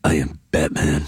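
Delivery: very low Batman growl; deep, rough superhero impression. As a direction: drop way down into a Batman voice. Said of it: "I was doing Batman's voice".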